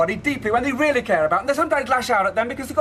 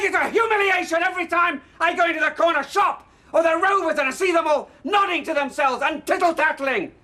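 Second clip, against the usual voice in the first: bitter angry rant; 5 semitones higher than usual. Acted. Father raging at his daughter about her mother, building to shouting. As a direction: pour out years of humiliation, rising to a roar.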